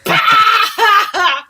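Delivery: loud, high laughing bark; imitating a friend laughing it off. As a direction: burst of mocking laughter. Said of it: "All he's doing is laughing at me".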